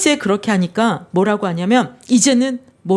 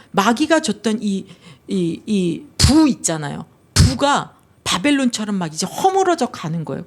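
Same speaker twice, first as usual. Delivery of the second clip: usual speaking voice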